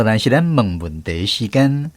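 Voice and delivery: ordinary delivery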